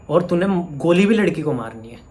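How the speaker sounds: indignant outburst. Real, not acted. Outraged rebuke of commenter.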